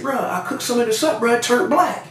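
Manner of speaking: high-pitched aggrieved report; retold complaint.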